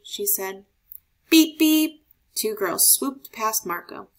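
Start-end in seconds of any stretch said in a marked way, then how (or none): 1.3-2.0 loud, high bike-horn beeps. A narrator's reading. Narrator sounding the girls' bicycle horns, loud and high.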